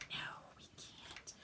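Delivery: a quick playful whisper to herself. Real, not acted. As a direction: whisper it like a little secret. Answers her own question playfully.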